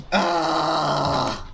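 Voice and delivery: long, sustained "aah" yell; drawn-out yell, sliding slightly down; frustrated scream. Real, not acted. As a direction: hold a wordless cry of frustration. Frustrated at having no iron.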